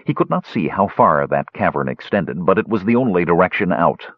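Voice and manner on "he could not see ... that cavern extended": ordinary delivery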